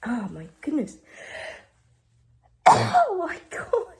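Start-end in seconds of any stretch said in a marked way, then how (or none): 2.7-3.9 exasperated exclamation. Real, not acted. Exasperated after many sneezes.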